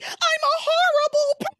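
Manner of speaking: high, loud hysterical wail; spiraling Teresa. Acted. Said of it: "She starts spiraling out of control".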